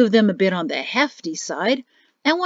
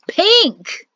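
"Pink!" much higher, loud, falling; exasperated cry; 10 semitones higher, 6 dB louder than usual. Acted. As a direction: blurt "Pink!" in outrage.

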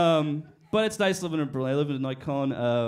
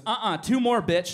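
tough spotter; 5 semitones higher, 6 dB louder than usual. Retold or imitated. Spotter pushing him.

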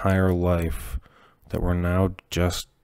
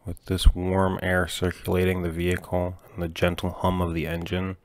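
plain reading voice

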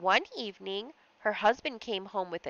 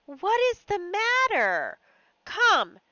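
higher, louder worried husband voice; concerned husband; 10 semitones higher, 4 dB louder than usual. Acted. Husband asking his odd-acting wife, higher and concerned. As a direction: press her gently but urgently.

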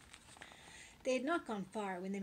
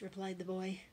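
ordinary delivery

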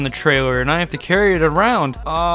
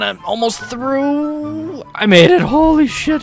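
excited; 9 semitones higher, slower than usual. Real, not acted. Squeezing past with plane.

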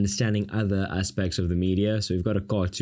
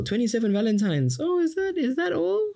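put-on spoilt girl voice. Teacher mocks Elizabeth's ungrateful reply in a very high squeak. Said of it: "But this girl".

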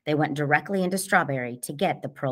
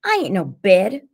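loud, higher indignant outcry; exclaiming; 5 semitones higher, 9 dB louder than usual. A narrator's reading. Cassie protests as T J's hands fall on her, exclaiming. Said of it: "I exclaimed".

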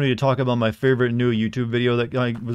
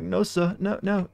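mock pleading; 7 semitones higher, 4 dB quieter, slower than usual. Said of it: "Harry's like".

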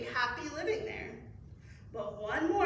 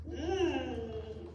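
plain reading voice

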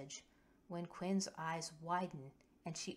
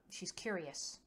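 usual speaking voice

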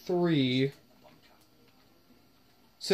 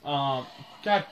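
ordinary talk, nothing marked

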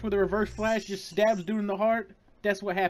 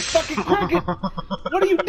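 panicked shout; 9 semitones higher, 5 dB louder than usual. Real, not acted. Panic at boss attack.

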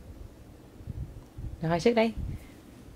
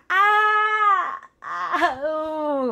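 mock crying; 9 semitones higher, much slower than usual. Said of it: "mẹ khóc nè".

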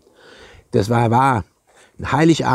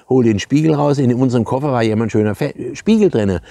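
ordinary talk

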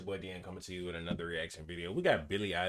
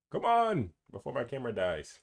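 sharp high plea at the camera, louder; impatient; 6 semitones higher, 7 dB louder than usual. Real, not acted. Creator begging his camera not to die, high impatient plea. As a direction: hurry the dying camera along, exasperated.

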